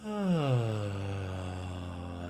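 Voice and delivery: long "ah" sigh falling steeply in pitch; audible sigh out. Shown as a demonstration. Guide showing how to sigh out with a sound, long falling sigh. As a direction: let out a big, drawn-out releasing sigh.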